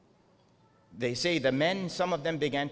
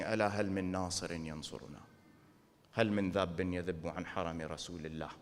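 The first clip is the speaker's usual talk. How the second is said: recited call for help; 7 semitones lower, 6 dB quieter, much faster than usual. Retold or imitated. Quoting Imam Hussein's call in Arabic.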